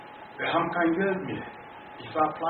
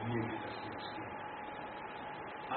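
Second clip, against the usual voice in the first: faint reply; 6 semitones lower, 16 dB quieter, much slower than usual. Retold or imitated. Sister answers at night, distressed.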